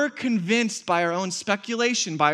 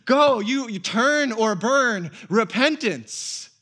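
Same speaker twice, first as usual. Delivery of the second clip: louder, higher mock fire-and-brimstone preaching; hammed-up hellfire sermon; mock angry preacher shouting turn or burn; 5 semitones higher, 5 dB louder, slower than usual. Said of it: "angry preacher".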